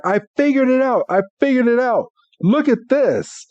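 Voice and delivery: high, urgent mock-excited announcement; excited retelling. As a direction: act out someone bursting with a discovery. Retelling Brock running over with news.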